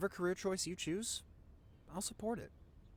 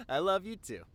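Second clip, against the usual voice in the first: affectionate; 5 semitones higher, 4 dB louder, slower than usual. Real, not acted. Affectionate goodbye with kiss.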